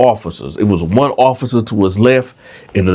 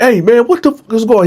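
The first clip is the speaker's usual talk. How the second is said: guard's demand; 12 semitones higher, faster than usual. Retold or imitated. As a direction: burst in demanding answers. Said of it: "he come in there like".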